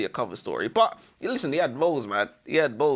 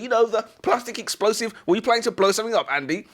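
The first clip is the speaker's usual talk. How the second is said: pressing interrogator; 8 semitones higher, 3 dB louder than usual. Acted. Acted interrogator pressing prisoner.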